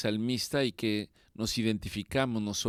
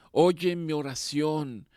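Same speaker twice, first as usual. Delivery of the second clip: louder, higher and slow plea; pleading cry; 6 semitones higher, 4 dB louder, slower than usual. Acted. Preacher ending his prayer, crying to the Lord slowly and loud. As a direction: stretch out each word, begging to be heard.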